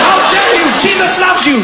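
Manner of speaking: very high, loud outraged cry; loud high fury; furious shout. Acted. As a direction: shriek it in holy fury.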